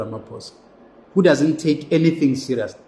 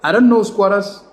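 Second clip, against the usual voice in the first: louder and higher, fed-up burst; exasperated outburst; 6 semitones higher, 5 dB louder than usual. Real, not acted. Vlogger venting at squatters about demolitions, exasperated louder outburst. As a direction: let the frustration spill out. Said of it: "Maybe I'm too angry".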